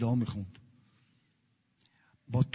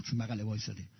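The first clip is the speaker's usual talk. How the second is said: ordinary talk, nothing marked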